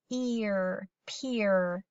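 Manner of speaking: PEER broken into p, then ear, then blended as peer; sounded out. Shown as a demonstration. Teacher decoding the base word, p, ear, then peer blended. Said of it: "the base word would say".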